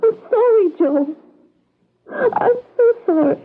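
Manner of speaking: crying apology. Acted. Pris sobbing her apology to Joe, voice breaking.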